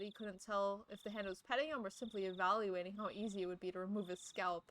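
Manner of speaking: ordinary talk, nothing marked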